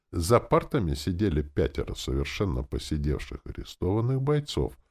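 usual speaking voice

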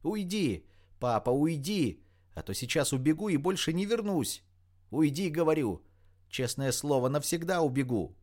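frantic yelling. A narrator's reading. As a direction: drive father off with desperate threats. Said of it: "услышала Рита из комнаты истошный вопль Катерины".

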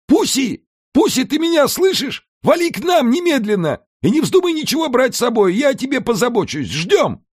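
high, eager calling into the phone; joyful call. A narrator's reading. Alfred calling Pusi on the phone, joyfully shouting. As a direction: summon her home gladly. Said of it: "Радостный Альфред закричал в трубку".

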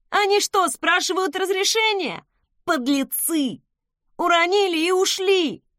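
high-pitched, indignant whining; aggrieved complaint. A narrator's reading. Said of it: "пожаловался он".

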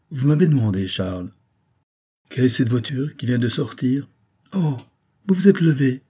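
a fond wife chiding her husband; a hint of a caring wife; wife's voice. A narrator's reading.

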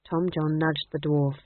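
usual speaking voice

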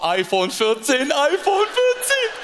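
slow, rising excited squeal; hope aloud for the phone, rising high on the second; hammed-up hopeful husband; mock excitement. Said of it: "du so".

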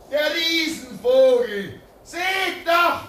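put-on child-like amazement at the plane; awed excitement. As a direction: marvel at the giant bird out loud. Awe at the giant aircraft.

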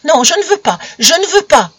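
put-on high, loud struggling child; child's cry of refusal. A narrator's reading. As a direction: cry out and fight the grip. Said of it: "cria l'enfant, se débattant".

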